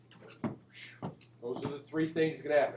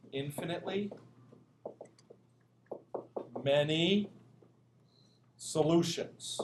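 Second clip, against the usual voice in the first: slow dictation; slower than usual. Shown as a demonstration. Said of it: "Here's what you write".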